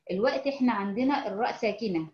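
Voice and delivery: ordinary talk, nothing marked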